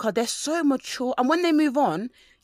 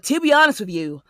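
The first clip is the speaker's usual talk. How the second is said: lightly newscaster-like, louder read; 7 dB louder than usual. Retold or imitated. Imitating the guy's reply as a mild newscaster. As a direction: deliver it like a formal news read. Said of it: "making him sound like a newscaster".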